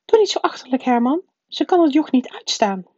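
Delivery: high, loud woman's rebuke; scolding aunt. A narrator's reading. Wife dismisses her husband's silly idea, scolding. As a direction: put him down in a high, sharp voice.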